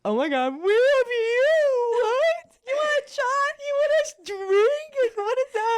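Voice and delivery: very high, loud, tearful squealing; retelling meeting their hero in a shrieking sob-squeal; hysterical fan squeal. Retold or imitated. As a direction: gush like an overwhelmed fan about to cry. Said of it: "we were like".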